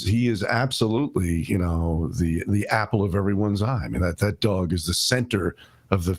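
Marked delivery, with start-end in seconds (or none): none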